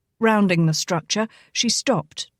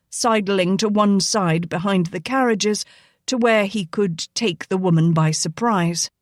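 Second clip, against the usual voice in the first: ordinary delivery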